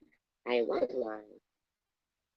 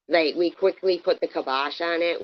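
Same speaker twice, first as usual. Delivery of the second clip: usual speaking voice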